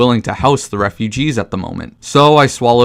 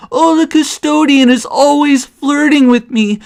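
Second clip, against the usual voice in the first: mocking whiny Johanka. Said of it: "going on about".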